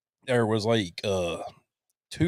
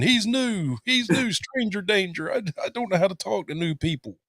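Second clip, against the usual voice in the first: mock inner screaming; 7 semitones higher than usual. Introvert screaming inside at strangers, high mock panic. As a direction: squeal it like an alarmed introvert. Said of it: "I'm screaming".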